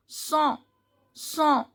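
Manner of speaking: sont said "son" with nasal vowel, no T; nasal ON demo. Shown as a demonstration. Teacher models a French word for learners, demonstrated nasal pronunciation. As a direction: repeat sont ending on a nasal "on". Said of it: "O N sound is on".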